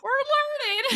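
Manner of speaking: very high, strained echo; mock tearful squeak. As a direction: imitate squeaking through tears of laughter. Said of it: "I have tears streaming down my face".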